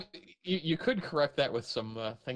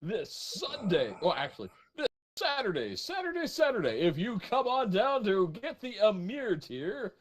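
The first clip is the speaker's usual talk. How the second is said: monster truck ad; 8 semitones higher than usual. Acted. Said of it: "monster truck announcer level echo".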